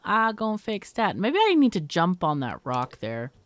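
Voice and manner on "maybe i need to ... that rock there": ordinary talk, nothing marked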